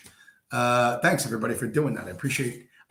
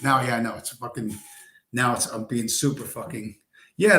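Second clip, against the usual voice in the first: ordinary talk, nothing marked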